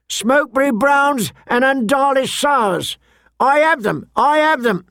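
high, sing-out market vendor's patter; wine seller crying his wares. Acted. Merchant calling his wines to passers-by, high vendor's cry. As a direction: hawk wines to the passing crowd, pitched high.

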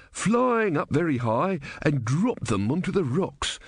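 put-on slow droning old know-it-all; elderly man droning. A narrator's reading. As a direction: lecture slowly like a bore.

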